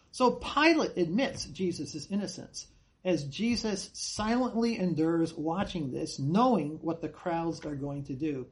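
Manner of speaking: ordinary talk, nothing marked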